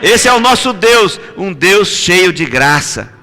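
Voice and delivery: full-voiced preacher exhorting; fiery preaching. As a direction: preach it out to the whole church. Preaching to the congregation.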